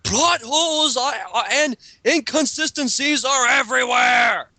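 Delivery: loud, high burst of exasperation; exasperated shout. Real, not acted. Reviewing a fan character, shouting at the plot holes. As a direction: throw up your hands and yell it.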